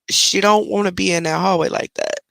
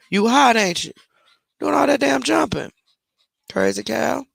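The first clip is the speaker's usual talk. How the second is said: teasing the dog; 6 semitones higher than usual. Real, not acted.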